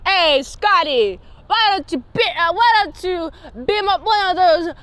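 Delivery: casual mobster order to the crew; Chicago mob accent tossed at Scotty. Retold or imitated. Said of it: "nineteen twenties lingo".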